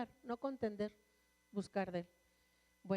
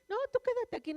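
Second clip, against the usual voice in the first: much higher, put-on voice; imitated brush-off; 12 semitones higher, 4 dB louder, faster than usual. Husband and son telling mother to stay behind, imitated brush-off. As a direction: jump high to mimic family waving you away.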